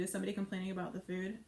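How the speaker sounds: ordinary delivery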